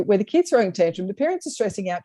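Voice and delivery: ordinary talk, nothing marked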